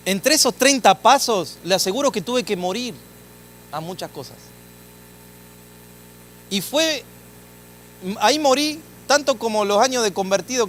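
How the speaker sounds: fervent sermon climax; preaching at full force. Acted. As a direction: preach it to the back row.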